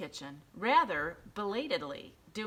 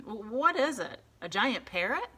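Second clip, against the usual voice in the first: startled policeman; 5 semitones higher, 6 dB louder than usual. A narrator's reading.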